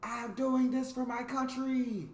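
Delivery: high, drawn-out battle cry; heroic cry. Acted. Englishman leaping from the plane, heroic cry. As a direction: cry it out high and slow, stretching the words. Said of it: "leapt out of the plane with the cry".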